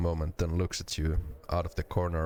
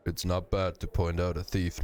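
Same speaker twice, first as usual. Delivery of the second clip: usual speaking voice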